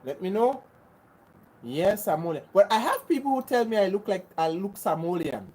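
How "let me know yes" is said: ordinary talk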